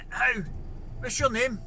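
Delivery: retold back-and-forth at a window; calling out. Repeated challenge in window exchange.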